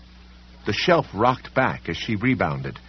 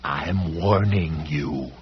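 slow menacing warning; 4 semitones lower, much slower than usual. Acted. Policeman threatens slowly.

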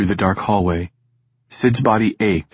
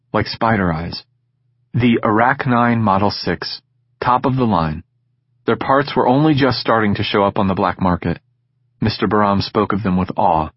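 ordinary delivery

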